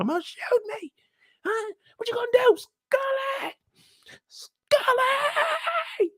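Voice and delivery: high put-on woman's voice wailing a name; woman screaming for Scully from the street; taunting woman crying out. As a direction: goad them, then wail for him. Woman keeps taunting, calls Scully.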